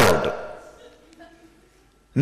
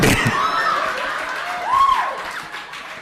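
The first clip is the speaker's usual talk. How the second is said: big high laugh; 5 dB quieter than usual. Real, not acted. Laughs at own joke.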